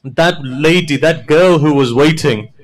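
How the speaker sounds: high, loud wailing recitation; preaching at full force. Acted. Preacher voicing Imam's memory of Sakina, full wailing. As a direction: pour out the grief at the top of the voice.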